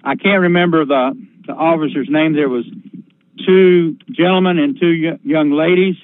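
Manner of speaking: plain reading voice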